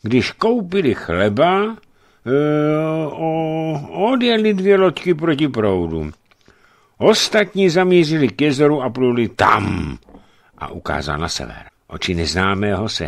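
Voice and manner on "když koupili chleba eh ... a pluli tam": put-on a nervous young servant groping for words; hesitant servant. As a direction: stammer it out like a timid servant. Servant Jakub answers.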